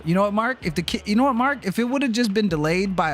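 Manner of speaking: high, pleading call to Mark; exasperated high call to a friend. Real, not acted. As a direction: throw the question up at Mark, pitch climbing.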